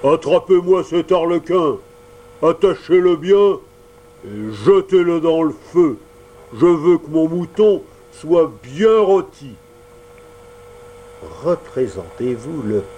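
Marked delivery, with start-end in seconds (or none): 0.0-9.6 loud, higher gruff commanding voice; booming puppet master giving orders; big voice. Acted. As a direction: order them to throw him in the fire. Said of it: "de sa grosse voix".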